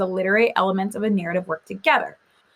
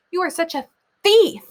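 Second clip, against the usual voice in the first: higher and louder, leaning on the italic words; stressed italics; 7 semitones higher, 4 dB louder than usual. Shown as a demonstration. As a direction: give "You are such a thief" extra oomph. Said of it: "put these words in italics".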